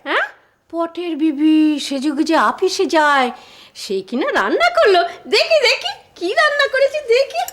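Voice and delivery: big sing-song swoops of fake wonder; mocking disbelief. Mother mocks new wife's cooking. (ব্যঙ্গভরা অবিশ্বাসে, সুর টেনে)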